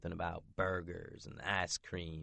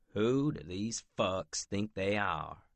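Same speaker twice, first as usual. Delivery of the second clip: plain reading voice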